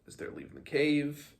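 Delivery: plain reading voice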